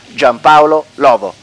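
ordinary talk, nothing marked

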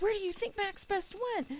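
put-on high, sing-song question to the kids; kid-show question. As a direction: ask it like a cheery performer prompting children. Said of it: "another little girl, we're like".

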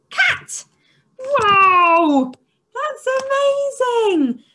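loud high gushing wow; delighted exclamation. Shown as a demonstration. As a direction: overflow with amazement for the child.

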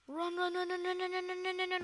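fast, high-pitched panicky babble; panicked fleeing. Real, not acted. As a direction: rattle it off high while running for it. Fleeing villagers in the game.

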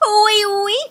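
high, bright-loud happy French yes; happy agreement. A narrator's reading. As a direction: chirp it out, delighted. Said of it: "Missus Poodle agreed happily".

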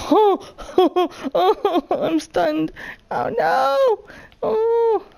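high, loud wails with nervous laughter; panicking. Real, not acted. Player stunned by attacking zombies, panicking. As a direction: wail in panic, pitch leaping up. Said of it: "Oh, I'm panicking".

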